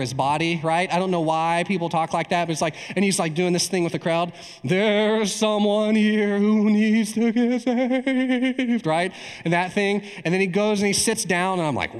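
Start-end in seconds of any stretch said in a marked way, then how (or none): 4.6-8.9 high, drawn-out wobbling church voice; mock a preacher's quavering altar-call intonation; tremolo pastor voice. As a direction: stretch every word in a ghoulish tremolo. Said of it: "He's got this tremolo voice".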